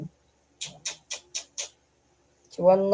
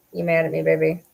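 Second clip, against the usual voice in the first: ordinary talk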